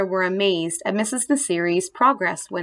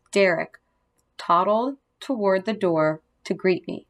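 plain reading voice

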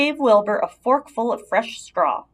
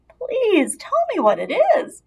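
high, pleading little pig; lightly voiced pig begging to know; 13 semitones higher than usual. A narrator's reading. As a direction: plead with her like an eager small pig. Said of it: "begged Wilbur".

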